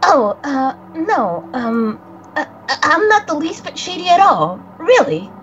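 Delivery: lightly voiced young boy, nervous and guilty; a hint of a jittery spirit boy who fears he killed someone; young boy character. Friend voicing the visual novel's boy, lightly nervous. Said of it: "Coco, please, please take it away".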